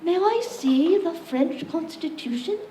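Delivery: put-on light posh British accent, slow and high; a Brit asking politely in a mild British accent; a hint of a British accent; little British accent. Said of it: "I'll try and do a little accent".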